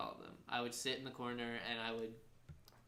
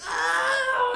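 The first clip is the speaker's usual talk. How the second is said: very high, screaming "oh"; imitated crowd cheer; 15 dB louder than usual. Storyteller imitating everyone grabbing him, ecstatic crowd shout. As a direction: scream like a crowd going wild. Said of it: "it was just like".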